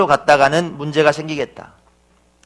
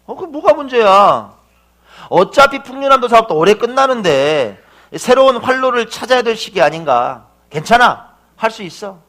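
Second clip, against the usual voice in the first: higher, louder cheering him on; encouraging pep talk; 6 semitones higher, 5 dB louder than usual. Retold or imitated. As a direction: boost his confidence, upbeat. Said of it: "이렇게 힘을 줬습니다". (힘을 북돋우며 높은 톤으로)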